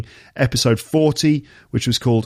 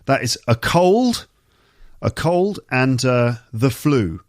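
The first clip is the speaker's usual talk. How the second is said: slow and deliberate, each word laid out clearly; slow careful enunciation; slower than usual. Shown as a demonstration. Teacher slowly presenting two words to learners, careful. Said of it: "a quick reminder of just two words".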